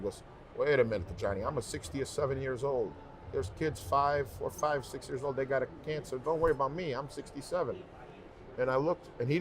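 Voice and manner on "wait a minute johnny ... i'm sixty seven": higher-pitched imitation of an Italian-accented father; heavy Italian accent. Dad brushing off his cancer to his son, heavy Italian accent. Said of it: "in a very heavy Italian accent".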